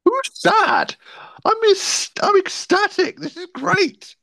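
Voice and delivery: very high, giddy squeal of joy; ecstatic gloating. Real, not acted. Gloating Seahawks fan after the Super Bowl, ecstatic.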